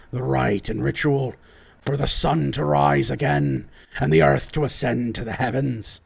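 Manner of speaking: rallying dwarf lord. Acted. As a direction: address loyal troops with solemn pauses. Lord rallies the bannermen.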